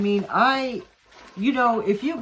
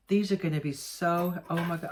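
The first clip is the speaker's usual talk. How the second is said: ordinary delivery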